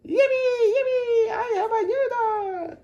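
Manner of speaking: put-on a high, loud, laughing child's cheer; empolgação infantil. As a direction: squeal for joy like a kid getting a puppy. George cheers over new dog.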